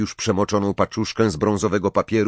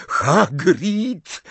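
higher, breathless-style exclamation; panting call; 7 semitones higher than usual. A narrator's reading. Harry runs into Hagrid on the road, panting. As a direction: gasp the name out. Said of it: "wydyszał".

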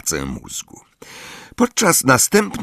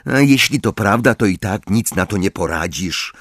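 plain reading voice

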